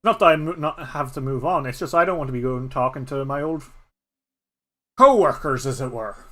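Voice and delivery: loud, gruff refusal; grumpy old badger. Badger Nullig refusing Kieran, loud and gruff. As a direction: grumble it out like a stubborn old badger. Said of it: "Nullig kinda grunts and says".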